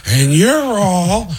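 high, drawn-out mock courtroom shouting; escalating hammed-up actor's tirade; Al Pacino. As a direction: build to a hugely stretched, high-pitched yell. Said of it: "as Al Pacino".

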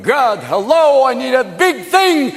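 high, drawn-out holler; calling to God. Acted. Moses asking God for help, high calling out. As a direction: call up to heaven, stretching the words. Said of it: "called to God".

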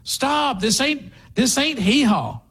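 high-pitched, acted exasperation; incredulous protest. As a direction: cut in as if stopping a ridiculous act. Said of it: "but you'd go".

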